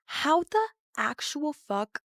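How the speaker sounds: slow, every word of "how the actual fuck" stretched out; drawn-out disbelief. Real, not acted. Storyteller asking the audience in slow disbelief. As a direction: lean hard on "actual" in disbelief.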